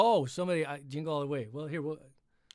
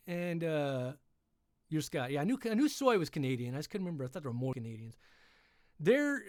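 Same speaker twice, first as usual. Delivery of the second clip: raised storyteller's read; lifted read-aloud narration, slightly accented; story narration; 5 semitones higher than usual. A narrator's reading. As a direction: keep reading the tale aloud.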